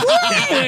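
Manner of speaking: a sudden high squeal of laughter; burst of laughter. Real, not acted. A friend retelling a street fight bursts out in high laughter.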